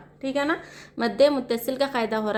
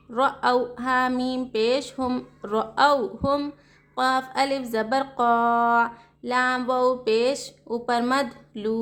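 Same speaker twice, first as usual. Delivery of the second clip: slow, drawn-out sing-song letter spelling; slow spelling drill; much slower than usual. Shown as a demonstration. Teacher spelling out Quranic words for students, slow sing-song chant. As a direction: chant each letter and vowel, then the joined syllables.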